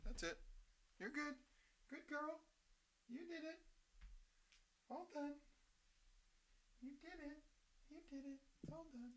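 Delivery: high pet talk. Real, not acted. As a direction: coo praise up high like to a puppy. Praising pet after giving medicine.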